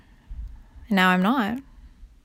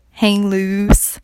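surfer dude; 4 dB louder, much slower than usual. Pretend. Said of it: "the basic surfer term".

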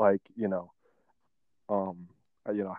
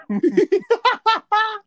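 shrieking burst of laughter, much higher and louder; 9 dB louder than usual. Real, not acted. Friend howls at the burger story, shrieking laugh. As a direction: howl with laughter.

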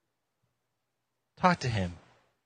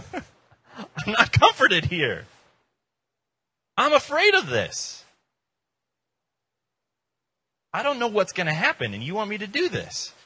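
mock frightened plea; 8 semitones higher, 5 dB louder than usual. Believer at the cliff telling Jesus he's scared, high hammed-up fear. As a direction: ham up the panic, voice climbing.

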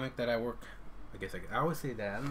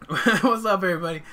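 podcast intro; 6 semitones higher, 10 dB louder than usual. Acted. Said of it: "welcome to another episode".

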